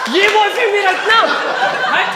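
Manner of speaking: put-on high, scornful girly voice; mock girl refusing. Mocking girl rejecting boyfriend. (ऊँची आवाज़ में लड़की की तिरस्कार भरी नकल)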